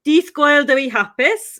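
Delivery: carefully sounding out "Dydd Gwener Du hapus" in Welsh; Welsh greeting. Shown as a demonstration. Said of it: "or as we would say in Welsh".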